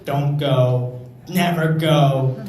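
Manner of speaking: hammed-up indignant warning; outraged one-star reviewer. Comic imitating a one-star reviewer, mock outraged. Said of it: "they're always just like".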